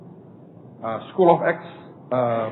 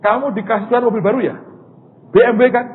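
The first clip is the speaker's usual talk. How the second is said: loud high call, half laughing; retold excited shout; 10 semitones higher, 8 dB louder than usual. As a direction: shout it out teasingly, pitched up high. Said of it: "dia teriak, dia ketawa-ketawa". (teriakan girang sambil tertawa)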